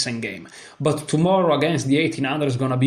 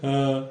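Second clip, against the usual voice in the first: helpless, breathy chuckling; real laughter; 8 dB quieter than usual. Real, not acted. Player tickled by a ridiculous rating gain, laughing. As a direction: burst of amused laughter, then a small chuckle. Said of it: "I'm laughing because this is ridiculous".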